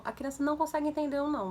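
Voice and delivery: usual speaking voice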